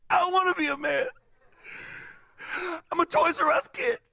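put-on very high little-kid whine; whiny scared kid. Scared kid refusing his friend's order, high whine. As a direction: plead in a squeaky child voice.

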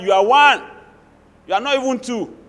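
ordinary delivery

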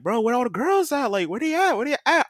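high-pitched, urgent questioning; eager guy asking around. Retold or imitated. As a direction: imitate a friend pestering for answers. Acting out guy hunting for girls.

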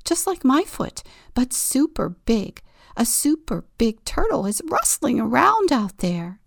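eager breathless telling; excited. Acted. Excited turtle report.

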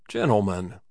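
ordinary delivery, nothing marked

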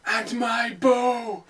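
slow, solemn, lightly acted; mock-epic, drawing it out; lead-up movie lines. Friend doing the lead-up lines for the Gimli bit, slow. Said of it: "I'll do the lead up".

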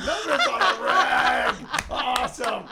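high, loud laugh through words. Real, not acted. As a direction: crack up while repeating it. Repeating the joke while laughing.

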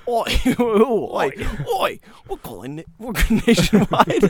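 put-on Australian phone caller; Australian accent. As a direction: call out as a cartoon Australian. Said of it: "He does Australian".

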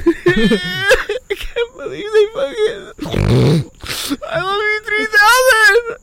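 high, drawn-out mock sobbing; choked-up crying voice, hammed up; tearful. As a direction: squeal it out as if bawling. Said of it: "You're making me wanna fucking cry".

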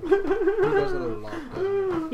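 very high teasing falsetto; teasing friend in a squeaky put-on voice; high mock voice. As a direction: tease him in a squeaky voice. Mock teasing voice about Thomas.

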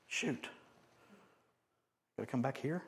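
soft, muttered mild disappointment; girl's reluctant thought. Acted. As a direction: think aloud, quietly let down. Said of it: "What was she thinking?".

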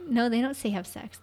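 normal talking voice

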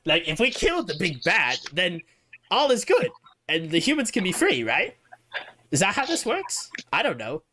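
fretful and jittery, wanting out; Warwick's nervous voice. Acted. The rat-man Warwick telling the party he wants to leave, nervous.

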